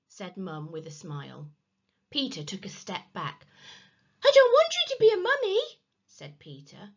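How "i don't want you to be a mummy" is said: very high, fast, loud plea; frightened wail. A narrator's reading. Peter backing away from Mum, frightened. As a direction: panicky child's squeal.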